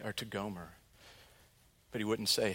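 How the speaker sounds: ordinary talk, nothing marked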